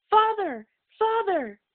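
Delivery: high, loud desperate calls for their father; children calling. A narrator's reading. Henry's children call to him from the slave line, high and loud. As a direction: call out across the crowd as they're led away.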